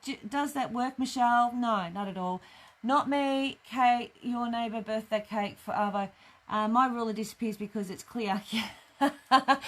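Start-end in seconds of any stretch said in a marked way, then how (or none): none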